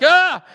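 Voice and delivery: sudden high, loud scream of fright; startled yelp. Retold or imitated. Driver startled by a knock on the car door. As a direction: jump at the bang. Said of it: "it terrifies me".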